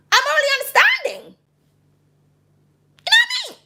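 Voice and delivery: squeaky knowing voice. Acted. Reader teasing Libra viewers, in a high squeak. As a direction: pop up into a tiny squeak.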